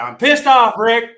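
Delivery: high, loud cartoon-kid outburst; mock-angry cartoon brat voice; Cartman. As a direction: play the fed-up kid at full pitch. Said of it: "And I'd say".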